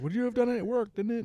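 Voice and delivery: high, loud mock smugness; smug retort. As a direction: toss it back smugly. Ware answers angry Davian.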